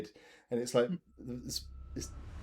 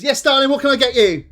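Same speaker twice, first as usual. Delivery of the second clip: high, loud barmaid; 10 semitones higher, 10 dB louder than usual. Acted. Robust barmaid greets the players, high and loud. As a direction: call out like a hearty pub landlady. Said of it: "a robust-looking woman comes over and says".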